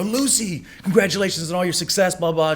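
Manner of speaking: high-pitched, effusive hello; gushing party greeting. Retold or imitated. Congratulating Lucy at the after party, gushing. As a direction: greet an old friend effusively.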